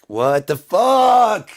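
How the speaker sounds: high, long drawn-out yell; loud, stretched howl of disbelief; screamed exasperation. Acted. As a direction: scream each word slowly in outrage. Repeats it as a scream.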